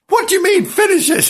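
high, indignant voice; Mistress's voice. Acted. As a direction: demand it high and outraged. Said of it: "said Mistress".

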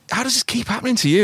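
very high, incredulous colleague voice; acted wide-eyed disbelief, pitched right up; mock amazement. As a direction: gasp it out like an amazed listener. Acts out amazed colleagues hearing boasts.

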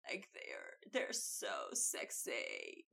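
sultry fried drawl; vocal fry. Shown as a demonstration. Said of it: "speak like this".

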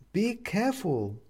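high, slow, concerned woman's voice; worried neighbour woman. A narrator's reading. Upstairs neighbour finding him on the floor, worried.

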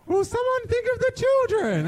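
put-on high, shrill-free pleading old letter writer; mock Green Guide Letters voice. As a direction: imitate the fussy complainer at a high pitch. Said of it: "the Green Guide Letters voice".

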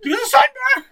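high, loud frantic shouting; frantic yelling. Acted. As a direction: yell it in a drunken panic. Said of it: "look around like".

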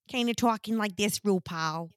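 lightly imitating a Midwest accent; a hint of Midwest accent, bubbly. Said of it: "tried to do this Midwest accent".

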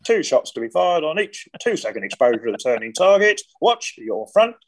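a hint of a barking range instructor. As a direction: call the drill like a range officer. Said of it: "an instructor behind going".

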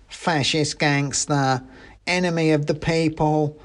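high, drawn-out accusation in character; denouncing mouse revolutionary. A narrator's reading. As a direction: denounce the cat as a little revolutionary.

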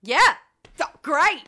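loud, eager approval; excited. Real, not acted. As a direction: burst out happily. Excited reaction to judge pick.

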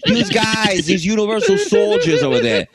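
hammed-up Chicago accent. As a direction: put on a loose Chicago pastiche. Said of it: "Chicago guy".

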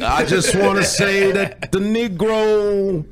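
high, loud, slow oratory with stretched words; booming parody of a historic speech; mock civil-rights orator. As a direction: draw the words out like a grand speechmaker. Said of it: "He's like this".

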